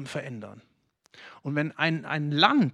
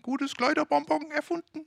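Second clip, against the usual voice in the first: high put-on cartoon voice; mock ad characters; 9 semitones higher than usual. Preacher retelling the Ricola ad, in a high mock voice. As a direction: voice the ad figures stirring the pot. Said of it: "die rühren gerade ... und sagen".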